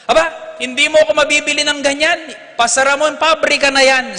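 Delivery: high, loud outraged priest; indignant refusal. Retold or imitated. Priest refusing the owner's bribe, outraged and loud. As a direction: throw the offer back at him.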